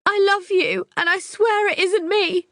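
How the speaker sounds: very high, loud tearful declaration; high sobbing plea. Acted. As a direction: sob it into his neck.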